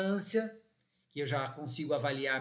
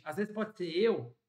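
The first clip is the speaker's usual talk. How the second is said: normal talking voice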